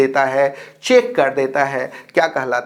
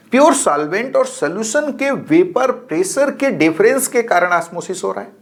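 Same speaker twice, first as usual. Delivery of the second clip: ordinary talk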